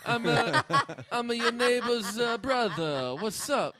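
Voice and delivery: high, loud, slow Boston-style voice; put-on Boston accent. Introducing himself as the neighbor's brother, put-on Boston accent. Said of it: "Now we're in Boston".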